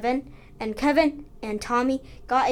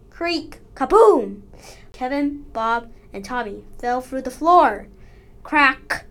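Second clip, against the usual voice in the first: ordinary delivery, nothing marked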